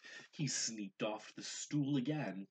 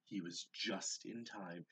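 ordinary delivery

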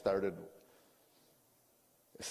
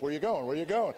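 badgering wife; 6 semitones higher, 6 dB louder than usual. Wife following him during argument.

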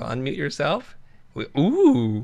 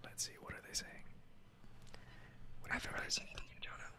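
soft breathy ASMR whispering; 25 dB quieter, much faster than usual. Acted. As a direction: whisper close to the mic.

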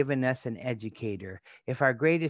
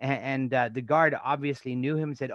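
ordinary talk, nothing marked

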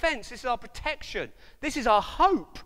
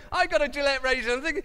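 ordinary talk

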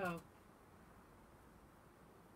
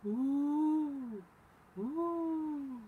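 long high hooted wind noise; drawn-out "ooh" of wind in trees; wind howl; 10 semitones higher, 9 dB louder than usual. A narrator's reading. Reader voices night wind in the trees, wind howl. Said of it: "The wind blew in the trees".

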